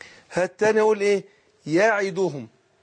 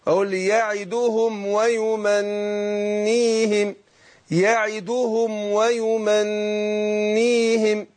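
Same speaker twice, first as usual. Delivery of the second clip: يعدهم and ويمنيهم drawn out very slowly, each syllable separate; slow careful demo; much slower than usual. Shown as a demonstration. Teacher to student modelling the verse very slowly. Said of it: "هكذا".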